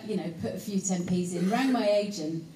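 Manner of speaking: usual speaking voice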